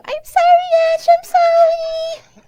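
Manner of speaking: high, loud frantic apology; high apologetic girl. Acted. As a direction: wail it out, sorry and panicky. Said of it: "pick her up and go".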